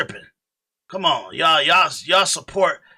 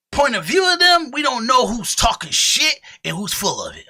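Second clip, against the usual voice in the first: high, loud heated rant; 4 semitones higher, 3 dB louder than usual. Real, not acted. Streamer ranting to his chat, high and loud. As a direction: preach it at the chat.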